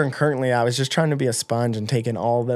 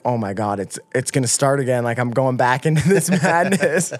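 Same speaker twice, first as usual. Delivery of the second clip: normal talking voice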